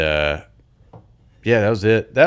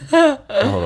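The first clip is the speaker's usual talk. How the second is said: high-pitched; 4 dB louder than usual. Real, not acted. High squeal again.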